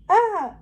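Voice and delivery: a high, loud scream of fright; the chef screams at the girl with a knife; chef's scream. Acted. High frightened yell. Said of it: "he screamed again".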